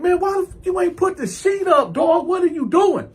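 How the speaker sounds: high-pitched exasperated shouting; indignant. Retold or imitated. Man scolding bunkie about the sheet, indignant.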